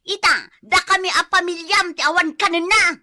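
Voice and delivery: high, loud, furious berating; angry scolding. Acted. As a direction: lash out at him for drinking with friends. Wife berates husband after drinking. (napigsa ken nakaro nga unget)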